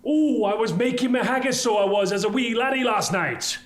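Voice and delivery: high, hammed-up Scottish lilt; Scottish accent. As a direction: put on a broad mock-Scottish accent, high-pitched. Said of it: "as in the Scottish verbiage for make".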